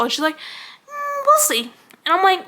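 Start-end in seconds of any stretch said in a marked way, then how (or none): 0.4-1.7 high, mock-doubtful boss voice; high-pitched, insincere stalling; noncommittal boss. Imitating boss's noncommittal answer.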